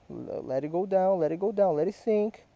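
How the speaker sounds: normal talking voice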